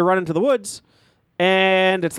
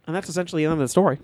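usual speaking voice

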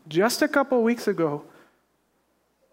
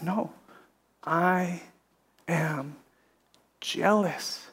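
softer, slow and grave; quiet voice of God; 3 semitones lower, 7 dB quieter, much slower than usual. Retold or imitated. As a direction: speak each word apart, solemn and hushed.

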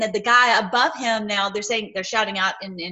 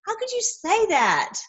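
higher rebuke; 9 semitones higher than usual. Shown as a demonstration. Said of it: "in other words".

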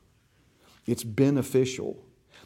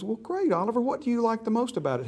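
much higher, imitated mom voice; high-pitched parent talking to a small child; 9 semitones higher, faster than usual. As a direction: play a mother asking her little boy. Mom voiced answering her little boy.

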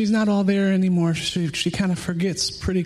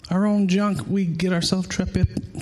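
ordinary talk, nothing marked

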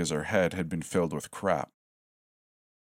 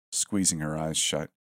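ordinary delivery, nothing marked

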